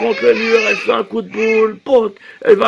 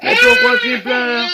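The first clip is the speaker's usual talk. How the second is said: mock panic. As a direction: overplay the alarm. Panicking over the crying baby.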